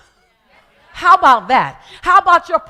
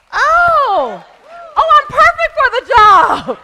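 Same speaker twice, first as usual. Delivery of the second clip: much higher and louder, bursting with laughter; laughing realization; 14 semitones higher, 7 dB louder, slower than usual. Retold or imitated. Telling the audience how the prayer hit her, laughing high. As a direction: laugh out the sudden joy of realizing. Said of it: "And I was like".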